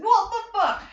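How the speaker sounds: amazed. Real, not acted. Disbelief at subscribers.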